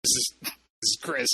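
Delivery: put-on drunk robber voice. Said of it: "he ever made any calls or texts".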